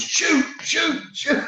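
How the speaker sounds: teammates yelling shoot. Retold or imitated. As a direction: scream shoot from behind like a desperate teammate. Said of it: "all I could hear behind me was".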